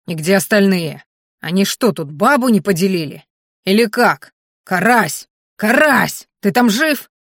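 loud calls across the room; shouting. Acted. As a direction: yell out for the missing man. Thug shouting for his mate.

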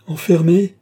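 loud, high cry of protest; full-voiced outraged cry; shouted cry. A narrator's reading. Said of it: "criait Lorenza sans écouter".

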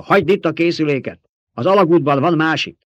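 high, loud bellow over the storm; yelled order. A narrator's reading. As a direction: yell the command at the radio operator. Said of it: "úgy ordította".

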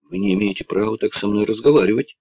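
ordinary delivery, nothing marked

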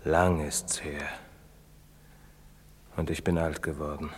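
a remembered sorrow, whispered. Acted. Old priest's confession, unvoiced.

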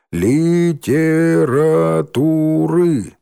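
slow, high, syllable-by-syllable reading. Acted. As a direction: spell it out slowly like poor readers. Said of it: "читали они в два голоса".

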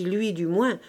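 usual speaking voice

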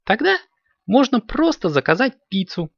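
put-on high-pitched girl kitten voice; clever little kitten. A narrator's reading. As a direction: pipe up high with a bright idea. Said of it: "придумала сообразительная Карамелька".

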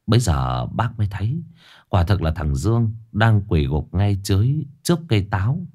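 plain reading voice

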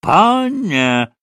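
high, loud, drawn-out cry; threatening shout. A narrator's reading. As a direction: shout it as a warning. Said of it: "прокричали оба пана с угрозою". (угрожающий высокий окрик)

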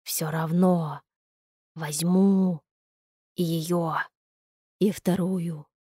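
gurgling monster voice, barely words; tentacle alien. A narrator's reading. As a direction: growl and gurgle like a hungry creature. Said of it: "непонятные звуки, в которых едва угадывались слова".

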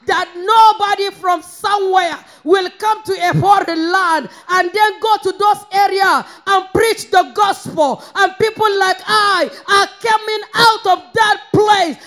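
impassioned sermon testimony; fervent preaching. Real, not acted. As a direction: preach out thanks to God without letting up. Preacher praises God at full force.

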